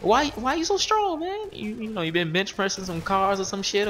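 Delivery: very high, rising disbelief; incredulous squeal. Retold or imitated. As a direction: squeal it in mock amazement. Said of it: "And I'm like".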